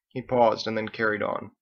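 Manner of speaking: usual speaking voice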